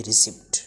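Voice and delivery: रिसिप्ट with the P sounded; wrong pronunciation. Shown as a demonstration. Teacher warning learners about receipt, wrong pronunciation. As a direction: say रिसिप्ट ending in "-sipt". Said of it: "wrong pronunciation इसकी होगी".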